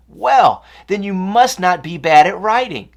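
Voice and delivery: loud, high upbeat cheer; eager encouragement. Acted. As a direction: lift him with eager pep. Annie cheerfully encourages Jordan.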